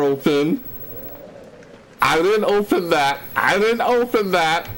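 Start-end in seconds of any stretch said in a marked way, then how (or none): 2.0-4.7 increasingly high, anxious insistence; rising fear. Real, not acted. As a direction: let panic push the pitch up. Finds door open, growing fear.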